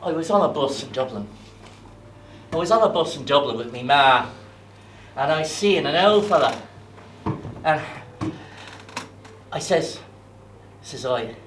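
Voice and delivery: ordinary delivery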